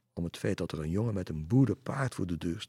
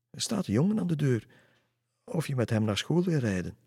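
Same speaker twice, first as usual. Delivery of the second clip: high, louder astonished announcement; mother telling her daughter a boy is at the door, amazed; puzzled mother; 7 semitones higher, 4 dB louder than usual. Acted. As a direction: tell it in disbelief.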